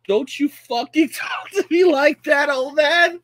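high, loud, slowed yelling with a laugh; furious mock shout. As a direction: scream it at the old man. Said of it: "the Gen Z is like".

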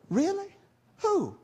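high, startled little question; surprised cashier. Retold or imitated. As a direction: pop up in surprise, as if caught off guard. Acted cashier reacting with surprise.

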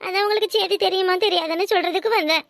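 ordinary talk

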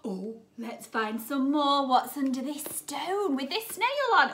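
plain reading voice

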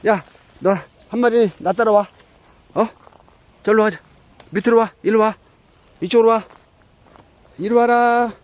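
high, loud calling out; yelling across the room. Real, not acted. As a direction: call the cat from far off. Calling cat across the graveyard.

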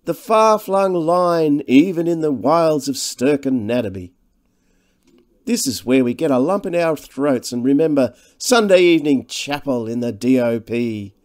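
high, declaimed parody of nostalgia; mock sentiment. A narrator's reading. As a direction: intone it grandly, tongue in cheek. Devine mockingly intones school sentiment.

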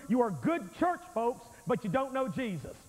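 ordinary talk, nothing marked

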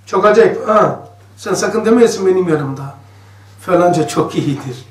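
ordinary delivery

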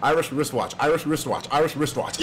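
quick tongue-twister run of "Irish wristwatch"; brisk, even repeats of "Irish wristwatch"; said fast. Shown as a demonstration. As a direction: rattle off "Irish wristwatch" three times in a row. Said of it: "I said it three times fast".

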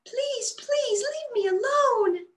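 high, slow little squeak of a plea; squeaky pleading bud. A narrator's reading. A bud begging Bella to go, slow high squeak. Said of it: "the bud squeaked".

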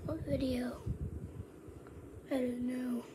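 hushed whisper to himself. Real, not acted. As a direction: whisper it, wondering.

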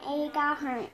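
usual speaking voice

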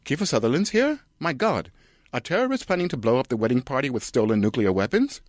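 put-on high, alarmed old man voice; panicked gasp about terrorists; John Hurt. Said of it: "John Hurt".

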